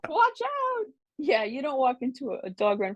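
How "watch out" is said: high mock alarm. Warning about hungry dogs.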